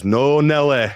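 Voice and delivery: uncle's English accent, lightly mimicked; imitated English accent. Co-host imitating his uncle telling off the dog, English accent. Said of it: "doing people's accents".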